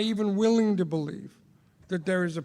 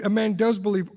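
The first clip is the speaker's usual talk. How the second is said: ordinary talk, nothing marked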